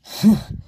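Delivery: sudden high laugh; burst of laughter. Real, not acted. Vlogger laughing at his own remark, real laugh. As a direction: laugh out loud at himself.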